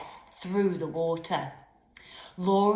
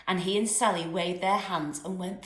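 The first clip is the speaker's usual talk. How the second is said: plain reading voice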